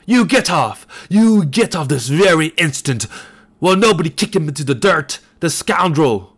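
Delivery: put-on furious old man bellowing insults after the rider; raving bellow. A narrator's reading. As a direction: rave and curse out of control. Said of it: "still raved and danced".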